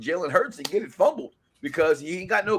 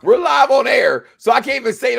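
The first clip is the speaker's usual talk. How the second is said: loud, laughing outburst; laughing shout; 4 semitones higher, 9 dB louder than usual. Real, not acted. Host laughing loudly on air at the co-host's mispronunciation.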